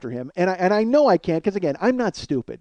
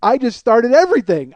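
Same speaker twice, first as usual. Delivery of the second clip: raised, loud voice as it dawns; alarmed realisation; 6 semitones higher, 8 dB louder than usual. Retold or imitated. Guard realising he set it all off, alarmed realisation. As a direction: exclaim it in dismay, not yet at full pitch.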